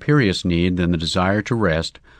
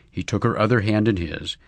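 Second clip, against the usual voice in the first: usual speaking voice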